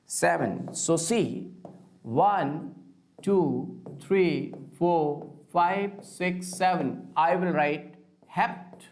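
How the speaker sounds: normal talking voice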